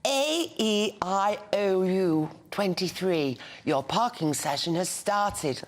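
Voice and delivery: put-on slow, careful posh English accent. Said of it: "put on a posh voice".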